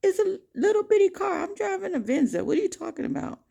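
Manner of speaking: high, squeaky mock voice of the other driver; mock the man as a shrill fool; imitating the angry driver. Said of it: "And the guy's saying".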